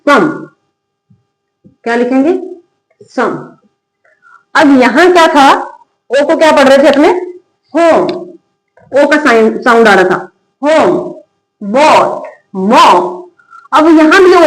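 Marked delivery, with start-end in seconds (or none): none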